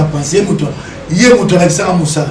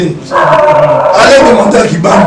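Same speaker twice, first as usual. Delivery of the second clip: very slow and louder, daring them; slow challenge; 2 semitones higher, 6 dB louder, much slower than usual. Real, not acted. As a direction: spell it out, slowly. (défi lent et appuyé)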